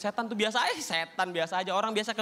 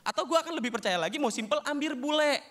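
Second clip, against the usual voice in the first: ordinary delivery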